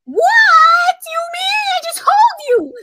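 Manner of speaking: high shout, slow and loud. Real, not acted. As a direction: yell it back in disbelief. Heated argument, shouting back.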